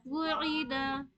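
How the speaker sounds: वुईदा stretched very slowly, each vowel held; drawn-out drill word. Shown as a demonstration. A teacher modelling the word for her class, very slow. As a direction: say वुईदा slowly with a clear वु then ई. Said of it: "देखिए तीनों चीजों की मश्क हो जा रही है".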